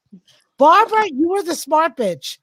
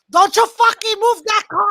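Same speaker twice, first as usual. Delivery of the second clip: imitated yell; 5 semitones higher than usual. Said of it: "telling her husband".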